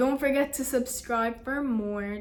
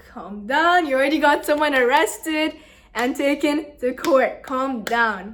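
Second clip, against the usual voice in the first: raised-voice telling-off; 2 semitones higher, 7 dB louder than usual. Retold or imitated. Teacher telling students to calm down, retold as a loud scolding. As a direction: imitate an exasperated teacher talking over you.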